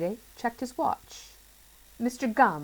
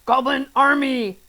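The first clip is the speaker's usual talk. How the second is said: loud, higher rallying cry; 6 semitones higher, 8 dB louder than usual. A narrator's reading. Mister Gum calling his goblin army, loud cry. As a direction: bellow the corrected title. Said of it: "he cried".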